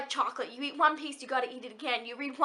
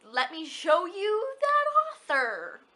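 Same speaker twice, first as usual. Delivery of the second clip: high, drawn-out silly voice; silly put-on voice; 5 semitones higher, much slower than usual. Host revealing the next author to viewers, silly voice. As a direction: stretch it out slowly in a goofy, raised voice. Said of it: "what was that voice?".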